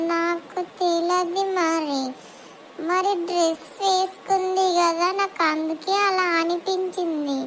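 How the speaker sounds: slow, drawn-out puzzled question; slow talker. Acted. Slow girl asks her friend about the old woman, very slowly. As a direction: let each word crawl out.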